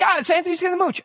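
very high-pitched mimicked woman; assistant's reply. Cassandra tells the host who it is, high-pitched. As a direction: pipe up in a high imitation of an assistant. Retold assistant identifies the guest.

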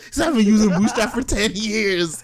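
high-pitched, laughing disbelief; barely holding back laughter at the absurd claim; laughing incredulity. Real, not acted.

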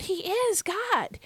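high and slow, "is" held long; emphasis on IS. Shown as a demonstration. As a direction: declare it with a soaring, stretched "is". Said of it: "why am I emphasizing this present tense verb".

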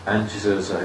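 ordinary talk, nothing marked

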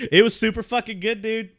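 laughing. Real, not acted. Insisting the pizza was good.

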